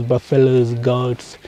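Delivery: ordinary talk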